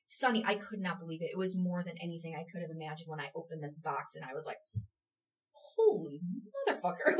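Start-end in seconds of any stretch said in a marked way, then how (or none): 5.8-7.2 stunned amazement. Retold or imitated. Opening the box, amazed.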